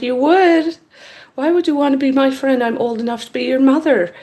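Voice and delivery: high, quick disbelieving questions; incredulous. Retold or imitated. Older woman questions young man's friendship offer, incredulous. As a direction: start high with surprise, then hurry on.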